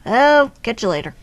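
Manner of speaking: usual speaking voice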